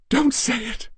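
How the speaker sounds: high, loud scream to cut him off; wife's frantic high outcry. A narrator's reading. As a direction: shriek at him to stop. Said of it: "she screamed".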